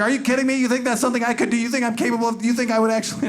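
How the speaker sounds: a hammed-up wife in disbelief; mock offended wife. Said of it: "she's like".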